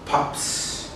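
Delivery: "pops" ending in a soft voiceless s; s sound. Shown as a demonstration. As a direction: breathe out the s in "pops". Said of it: "and it sounds".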